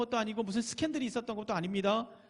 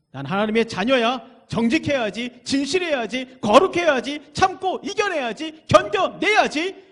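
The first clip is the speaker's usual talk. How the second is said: louder and higher, building to a cry; rising declaration; 5 semitones higher, 10 dB louder, slower than usual. Acted. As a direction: build each vow to a fervent shout. Preacher declaring resolve, building. (점점 고조되는 외침)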